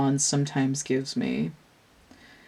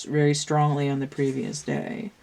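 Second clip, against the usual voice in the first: usual speaking voice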